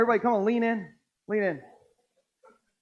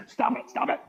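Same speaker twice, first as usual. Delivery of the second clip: mock hushed scolding; 4 dB quieter than usual. Mock scolding church lady.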